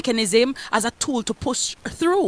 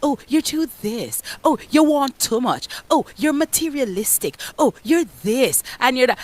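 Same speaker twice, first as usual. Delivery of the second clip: mimicking critics; 4 dB quieter than usual. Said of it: "society ... will try to castigate you".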